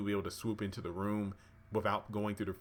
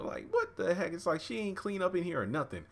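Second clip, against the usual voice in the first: high-pitched mock indignation; pitched-up comic grumbling; retold disbelief; 9 semitones higher than usual. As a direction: act out an appalled complaint. Retold maid appalled at messy room.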